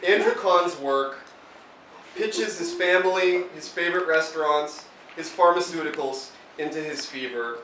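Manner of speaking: measured, emphatic tribute read aloud; formal introduction. Real, not acted. Host introducing a poet to the audience, formal tribute. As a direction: present the poet with deliberate, rounded phrases.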